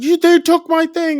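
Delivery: high, loud imitated protest; frantic accusation. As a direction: protest wildly that you were wronged. Man accuses children in quarrel.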